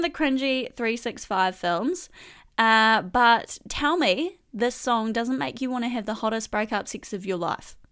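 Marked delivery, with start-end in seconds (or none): none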